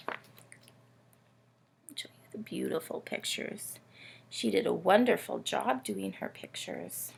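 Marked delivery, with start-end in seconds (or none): 0.1-3.8 soft, hushed whisper. Real, not acted. Host showing book pictures to children, whispering. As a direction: breathe it out as a quiet aside.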